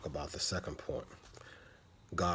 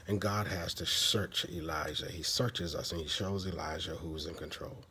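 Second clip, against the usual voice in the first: normal talking voice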